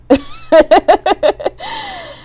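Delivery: loud, high real laugh; high burst of laughter. Real, not acted. Reader laughing at her own swearing, real high laugh.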